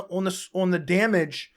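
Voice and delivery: usual speaking voice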